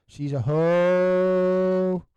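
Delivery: very slow, stretched hoe rising; drawn-out question. Real, not acted. Teasing co-host about Missy Hyatt, slow stretched question. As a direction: drag out hoe in disbelief. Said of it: "asking about Missy Hyatt".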